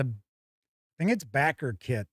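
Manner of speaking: ordinary delivery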